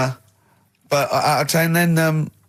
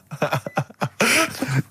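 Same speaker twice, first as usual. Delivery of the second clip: laughter; 3 semitones higher than usual. Real, not acted. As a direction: give a brief chuckle. Cracks up after reading the text.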